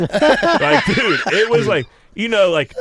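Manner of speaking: high, laughing through the words. Real, not acted. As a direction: crack up while telling it. Laughing about cold plunge.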